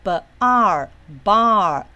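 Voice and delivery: "b-", then a long "r", then joined slowly as "bar"; sounds blended. Shown as a demonstration. Teacher joining the vowel to the consonant before it, slowly blended "bar". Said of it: "那我们怎么分".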